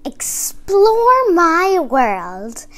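storybook read-aloud voice; kids' book narration; reading to children. A narrator's reading. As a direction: read it like a picture book to little kids.

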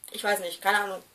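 ordinary talk

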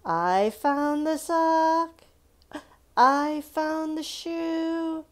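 high, loud, slow triumph; excited. Real, not acted. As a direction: announce it like a happy find. Celebrating found sock and shoe.

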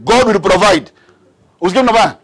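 full-voiced sermon exhortation; fervent preaching. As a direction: drive the point home to the congregation.

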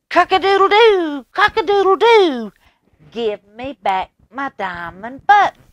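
very high, loud crowing cock-a-doodle-doo; shrieking rooster demanding his button back. Acted. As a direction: crow it out like a bold rooster. Rooster crows at king.

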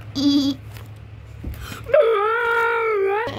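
chipmunk-voiced laugh; high squeaky laugh. Real, not acted. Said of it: "I sound like a chipmunk".

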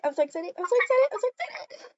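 high, fast giddy chant; excited chant, then calming; excited squeal. Real, not acted.

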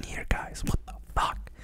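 voiceless "what the" whisper; hushed mock shock; whispered disbelief. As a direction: whisper it as if stunned. Mock realisation of the connection.

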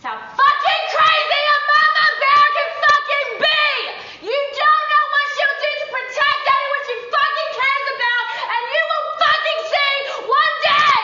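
high, loud furious yelling; furious rant. Acted. Mama bear yelling her confessional threat, furious.

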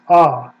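ah, open and loud; vowel ah. Shown as a demonstration. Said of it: "A vowel".

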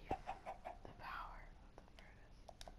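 dramatic hushed whisper. Pretend. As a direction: breathe it like a secret. Mock awe at furnaces.

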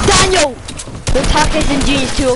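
high, loud yell for a teammate; calling out. Real, not acted. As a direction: shout his name across the map. Calling teammate mid-fight.

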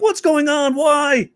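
high, baffled outcry; Jon Stewart impression. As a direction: pitch it way up in comic bewilderment. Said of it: "Jon Stewart used to use that all the time".

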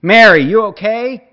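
husband calling into the house to his wife. A narrator's reading. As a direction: shout to check on his wife. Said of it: "rief er nach jemandem im Haus".

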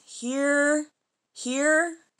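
usual speaking voice

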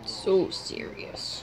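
whispered mock awe. Real, not acted. Reacting to a truck ad.

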